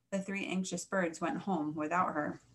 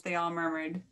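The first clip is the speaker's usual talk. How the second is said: plain reading voice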